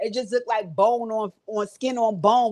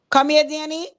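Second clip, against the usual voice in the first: raised, coaxing call; 6 semitones higher, 4 dB louder than usual. Retold or imitated. Vlogger mock calling Danny over, raised voice.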